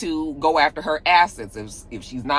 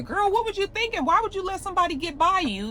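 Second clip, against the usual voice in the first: very high-pitched, toothless mock scolding; mock mild fussing; 14 semitones higher, 3 dB quieter than usual. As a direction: put on a squeaky-high, harmless fuss. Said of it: "a fuss from [name] is a little different".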